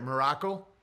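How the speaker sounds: usual speaking voice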